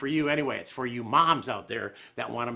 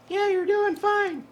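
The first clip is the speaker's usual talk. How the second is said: high, slow falsetto reply; slow falsetto reassurance; Sheila's voice; 3 dB louder, slower than usual. Pretend. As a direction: answer yourself in a squeaky lady voice. Voices absent Sheila answering him.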